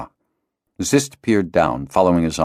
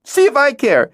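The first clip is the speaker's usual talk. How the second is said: put-on very high, louder girl's cry; a crying girl pushes her friend away; tearful outburst; 5 dB louder than usual. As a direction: hurl it at him, hurt. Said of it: "she cried, tears streaming".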